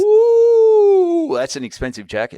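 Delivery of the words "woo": high, loud whoop of amazement; delighted woo at a big number; excited whoop. Real, not acted. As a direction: whoop at the price. Reacting to jacket price.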